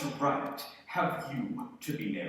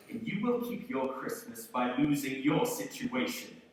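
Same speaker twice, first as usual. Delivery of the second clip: ordinary talk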